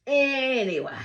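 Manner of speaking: high, loud, drawn-out ANYWAY; emphatic moving on. Real, not acted. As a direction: sing out "Anyway", stretching the start and falling away. Second, emphatic take to move on.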